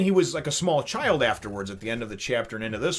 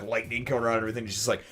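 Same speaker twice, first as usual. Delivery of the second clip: usual speaking voice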